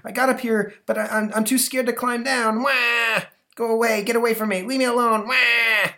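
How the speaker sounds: put-on high, loud wailing boy; scared whiny kid. Stuck boy telling rescuers to leave, high wailing. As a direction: whimper and wail like a stuck child.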